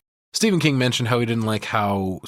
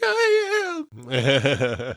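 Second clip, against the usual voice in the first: lightly sobbing, put-upon protest; mock crying Shelley; 4 semitones higher than usual. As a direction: whimper it through fake tears. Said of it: "She's like".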